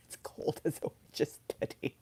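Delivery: hushed whisper of the saying. Retold or imitated. Quoting Kevin's cold saying in a whisper. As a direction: breathe it out as a secret joke.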